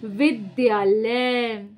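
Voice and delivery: very slow, drawn out; slow stretched answer; slow answer reveal. Shown as a demonstration. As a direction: stretch the word out for the class. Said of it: "तो मैं क्या लिखूंगी यहाँ पे".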